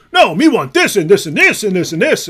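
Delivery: dopey mocking voice; mock dumb voice. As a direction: play him as a simple brute. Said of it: "He'll say".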